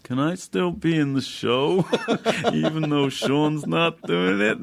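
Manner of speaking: pleading imitation. Rich calls asking to stay in the show, mock pleading. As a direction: beg to stay in the show, hammed-up. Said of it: "a call from Rich going".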